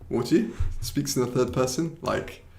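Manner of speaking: usual speaking voice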